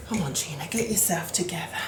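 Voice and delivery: low, soft self-talk; near-whispered pep talk under the breath; muttering to oneself. Real, not acted. Baker urges herself on while rolling pastry, muttering.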